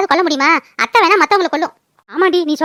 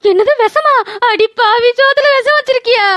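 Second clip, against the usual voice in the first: aghast, pitch climbing higher; shocked outcry; 4 semitones higher than usual. Acted. Aunt learns her food was poisoned, shocked outcry. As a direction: react in horrified disbelief.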